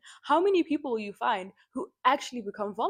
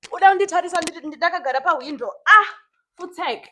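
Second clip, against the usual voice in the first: loud angry call to the neighbor; annoyed loud scolding in Shona; 7 semitones higher, 11 dB louder than usual. Real, not acted. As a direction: tell off the peeper loudly.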